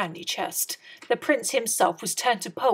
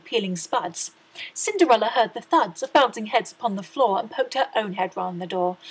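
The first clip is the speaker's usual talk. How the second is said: ordinary delivery, nothing marked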